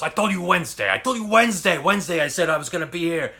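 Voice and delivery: high, loud, insistent mock rant, rising on the repeat; insistent boss. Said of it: "He goes".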